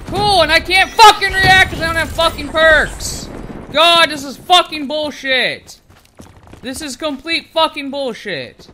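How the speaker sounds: real furious shouting, loud and high; angry rant. Real, not acted. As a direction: rage at the game at full volume, easing slightly. Rages about losing without perks.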